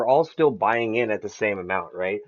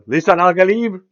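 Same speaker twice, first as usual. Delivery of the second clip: loud, higher-pitched mock acclamation; exclaimed film quote; 8 semitones higher, 7 dB louder than usual. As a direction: cry it out like a movie line. Quoting a Dune line with gusto.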